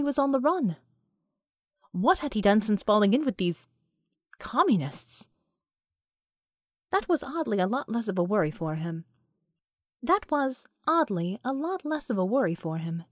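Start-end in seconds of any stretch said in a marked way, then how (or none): none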